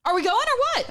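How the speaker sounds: high, mock-impatient mom call. Mocking her late mom to Eric, high and impatient. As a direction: hurry everyone up like a late mom. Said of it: "and she'll go".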